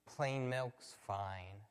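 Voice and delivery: slow, low and quiet, letting the hope go; resigned disappointment. Retold or imitated. Preacher echoing the dad's line, slow resigned letdown. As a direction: sigh it out like settling for second best. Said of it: "we have to end up saying".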